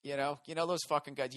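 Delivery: normal talking voice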